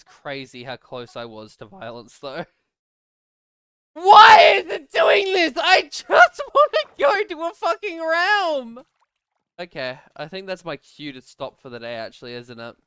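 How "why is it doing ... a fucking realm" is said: high, very loud exasperated yell; frustrated shouting. Real, not acted. Streamer raging at a game that won't cooperate, frustrated shouting.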